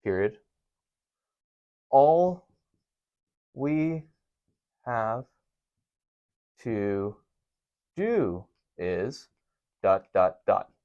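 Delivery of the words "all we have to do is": slow, softer word-by-word read-out; dictating slowly. Shown as a demonstration. Teacher writing an example on the board, slow. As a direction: drop each word as you write. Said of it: "That's another example".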